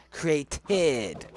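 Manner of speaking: "Created" drawn out with a distinct final "ted"; over-enunciated "Created"; careful self-correction. Real, not acted. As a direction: say "Created" slowly, sounding out each syllable. Corrects own slip on the word.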